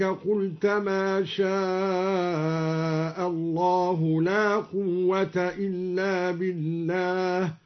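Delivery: very slow chant, ترني with a long final i; sounded ya. Shown as a demonstration. Teacher chants al-Duri's reading, ترني with long final i. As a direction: recite slowly, drawing out the ni of ترني. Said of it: "وأثبت الياء في ترن وصلا هكذا".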